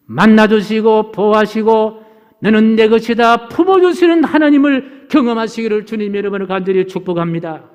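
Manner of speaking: loud opening, gradually settling; fervent preached blessing. Real, not acted. Preacher blessing congregation in the wilderness, fervent. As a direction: bless them with full pulpit force.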